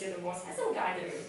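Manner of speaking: fast, low mock boast of the handsome guy; mock manly boast. Teacher mimics the student's boast, fast and low. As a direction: echo his swagger in a quick low voice. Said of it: "mimics the guy's line".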